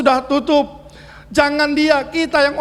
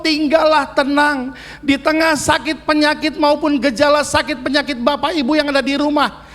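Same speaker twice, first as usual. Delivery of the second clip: ordinary delivery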